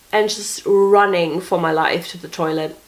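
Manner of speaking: normal talking voice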